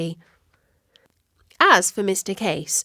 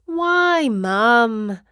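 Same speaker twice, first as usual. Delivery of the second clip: high pleading whine; 3 semitones higher than usual. Acted. Katie begging her mum to drop Latin, pleading. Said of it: "pleaded Katie".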